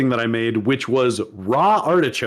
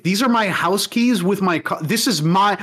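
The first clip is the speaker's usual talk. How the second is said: higher, insistent retold protest; exasperated, higher-pitched quote; imitated indignant protest; 6 semitones higher than usual. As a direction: act out a wronged owner proving it. Acting falsely accused car owner.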